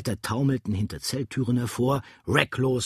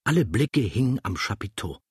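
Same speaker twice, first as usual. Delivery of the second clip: ordinary delivery, nothing marked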